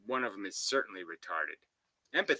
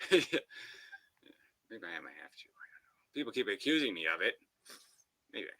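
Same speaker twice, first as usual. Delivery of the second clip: sly half-joking whisper; 3 semitones lower, 3 dB quieter than usual. Real, not acted. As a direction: hush it like a guilty secret.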